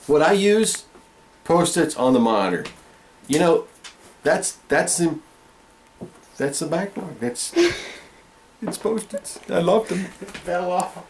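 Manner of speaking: ordinary delivery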